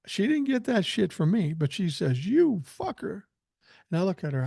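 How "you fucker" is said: high-pitched imitation of a small girl; playful high kid voice; little child voice. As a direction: mimic a three-year-old cursing, pitched way up. Retells little granddaughter swearing.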